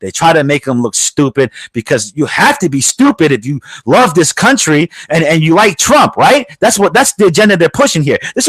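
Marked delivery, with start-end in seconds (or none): none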